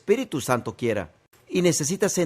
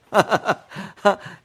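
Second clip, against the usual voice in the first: short joyful laugh; delighted laugh; 3 semitones higher than usual. Real, not acted. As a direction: laugh at the wonder of it. Preacher laughs after story.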